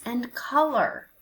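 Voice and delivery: plain reading voice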